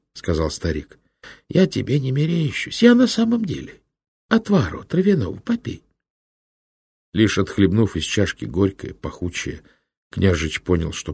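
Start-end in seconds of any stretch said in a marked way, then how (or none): 1.5-5.8 put-on a kindly old monk, a touch higher; old monk's voice. A narrator's reading. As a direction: speak as a gentle old hermit. (лёгкий голос доброго старого инока)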